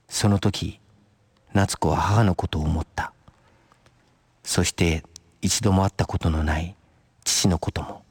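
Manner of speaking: low, subdued narrator with long pauses; story narration. A narrator's reading. Narrator telling of Natsuko's thoughts of her parents, low reflective narration. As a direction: let each phrase settle, low and even.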